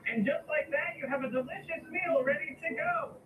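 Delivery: lightly voiced, listless ad read; flat-out unenthused sponsor read; bored ad read. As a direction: read the ad like you don't care. Reads sponsor ad in character.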